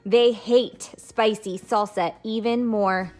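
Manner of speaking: lively picture-book read-aloud voice; animated storybook reading for kids; reading to children. Acted. Teacher reads picture book aloud.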